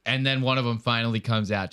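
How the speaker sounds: ordinary talk, nothing marked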